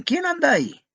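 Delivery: high, loud wary call. A narrator's reading. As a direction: shout to find who is there. Young man calls into darkness. (llamada aguda y alerta)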